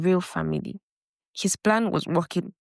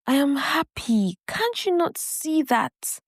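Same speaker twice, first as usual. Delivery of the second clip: pitched up high and pleading, slowing at the end; upset protest; 7 semitones higher than usual. A narrator's reading. Ifeoma snapping back at her worried brother, upset and high. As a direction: insist you're happy, hurt and defensive.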